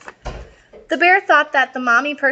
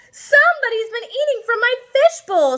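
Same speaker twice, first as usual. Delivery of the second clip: hammed-up dad complaining of a stolen meal; upset dad voice; 12 semitones higher than usual. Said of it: "said the daddy person".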